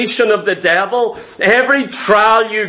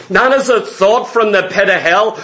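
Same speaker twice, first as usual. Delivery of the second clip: ordinary delivery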